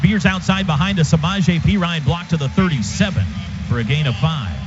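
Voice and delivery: usual speaking voice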